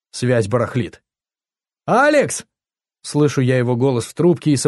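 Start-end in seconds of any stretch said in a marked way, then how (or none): 1.9-2.4 high-pitched call of a name; acted urgent hail; calling down the phone. A narrator's reading. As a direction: call out over a bad line. Friend calling on bad line.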